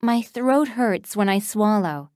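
normal talking voice

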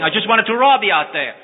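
high, loud disbelief; incredulous protest. Acted. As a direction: blurt it out, unable to understand. Thief baffled by kindness.

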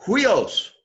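Huios opening on a breathed h, like "hwee-os"; teacher modelling Huios with an aspirated start; rough breathing. Shown as a demonstration. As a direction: sound the h before the w glide in Huios. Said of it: "Notice the rough breathing".

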